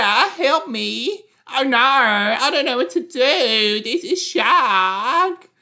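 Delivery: high, loud, frantic screaming for help; panicked swimmer voice. Pretend. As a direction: wail like a drowning swimmer. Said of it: "the way he's saying".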